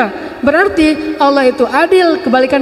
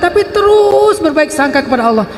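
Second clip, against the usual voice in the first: ordinary talk, nothing marked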